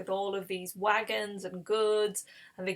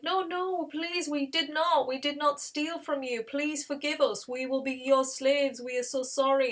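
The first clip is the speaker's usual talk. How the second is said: high, urgent pleading; acted desperate begging; 5 semitones higher than usual. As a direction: plead for forgiveness in a raised voice. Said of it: "begging for his forgiveness".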